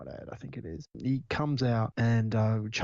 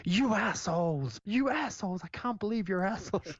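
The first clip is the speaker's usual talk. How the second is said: mock outraged yell; 10 semitones higher than usual. Podcaster imitates Joe realizing it's a ruse, mock outraged yell. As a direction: squeal it out as a pretend tantrum. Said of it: "he just yells out".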